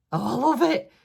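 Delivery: high and loud cry of delight; delighted gush. Real, not acted. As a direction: burst out in real joy.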